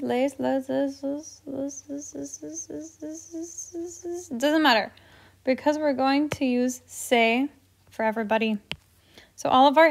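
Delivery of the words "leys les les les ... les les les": les repeated, fading to almost nothing; fading repetition. Pretend. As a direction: let each les die away quieter. Chanting les over and over, fading.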